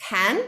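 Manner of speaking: "can" with an open vowel. Shown as a demonstration. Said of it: "a more open vowel".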